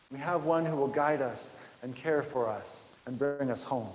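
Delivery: ordinary delivery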